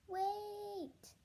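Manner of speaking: high, drawn-out call. Acted. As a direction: call out to halt everyone. Joy calls out to stop them.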